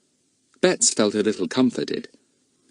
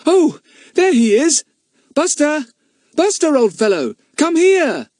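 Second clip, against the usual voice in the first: high, loud happy call; calling the dog; 7 dB louder than usual. A narrator's reading. Child calling the dog Buster, loud and eager. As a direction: call the dog across the garden.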